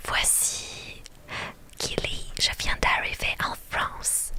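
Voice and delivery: put-on slow whispered French with an American accent. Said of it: "Un accent américain". (accent américain)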